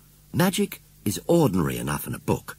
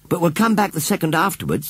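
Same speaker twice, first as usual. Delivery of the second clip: put-on raised, high girl's voice; rather loudly; 10 semitones higher, 4 dB louder than usual. A narrator's reading. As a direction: pitch it up for someone to overhear. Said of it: "She said it rather loudly".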